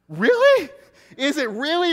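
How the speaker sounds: can you believe it; shocked disbelief at the pepper spray story; incredulous. Real, not acted.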